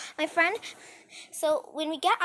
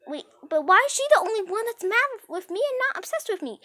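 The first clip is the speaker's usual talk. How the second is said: indignant; 8 semitones higher, 7 dB louder than usual. Retold or imitated. Friend's outraged question.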